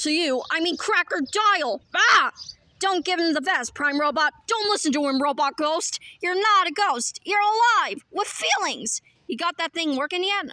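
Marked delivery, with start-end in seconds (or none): 2.0-2.3 a sudden, loud, high yelp; startled yelp. Acted. Villain startled by a sudden interruption, loud yelp. 7.2-9.0 urgent plea. Acted. Pleading intensely with the robot.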